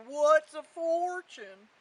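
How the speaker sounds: lightly voiced cow, loud and slow; slow, loud question from a puzzled cow; cow character. Cow answers Jack in story.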